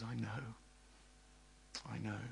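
soft, low, understanding voice; hushed, low comfort; very gentle. Acted. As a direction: console softly, leaving space between the words. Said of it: "able to deal with you gently".

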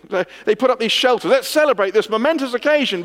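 hammed-up eager disciple; excited disciples. Preacher voicing Peter to the crowd, eager and loud.

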